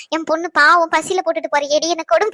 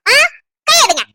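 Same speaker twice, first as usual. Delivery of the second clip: annoyed snap; 8 semitones higher, 5 dB louder than usual. Real, not acted. Wife pushes hand away again.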